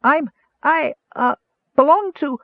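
very high, tiny squeak of a frightened mouse; squeaky mouse voice. Acted. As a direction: squeak it out like a cornered kender-mouse. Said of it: "Tass squeaked".

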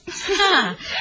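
a short, pleased, high laugh; proud laugh. Acted. Mother-in-law praising her daughter to her son-in-law, laughing. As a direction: laugh with pride in your daughter.

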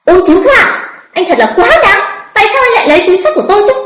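high, loud outraged protest, speeding up; angry loud scolding. A narrator's reading. Woman whose bag was snatched scolds the thief, angry and loud. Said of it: "mới tức giận mắng to".

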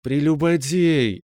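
high, slow, stretched-out and playful; affectionate drawl. A narrator's reading. Girlfriend undressing him, affectionate drawl. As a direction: drag it out fondly. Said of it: "ласково затянула девушка".

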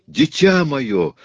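high, loud, drawn-out cry; a mother crying out to her child; exclaiming. A narrator's reading. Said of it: "воскликнула мадам Икс". (протяжное громкое восклицание)

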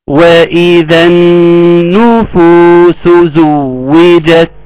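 slow, measured tajweed chant; Quran recitation. Shown as a demonstration. Teacher reciting verse after verse for students, slow recitation. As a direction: hold the long vowels and nasal noon.

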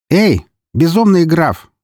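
slow, very high defiant shout. Acted. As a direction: call out to the camera slowly. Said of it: "крикнула она".

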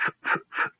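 voiceless stuck F sounds; croaked stammer. A narrator's reading. Speechless kender trying to name the old man, a voiceless croak. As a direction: try to speak but only air comes out. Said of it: "only a croak".

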